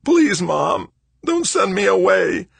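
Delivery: put-on very high, loud, childlike pleading; desperate begging. A narrator's reading. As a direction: implore your mother with everything you have. Son on knees begs his mother.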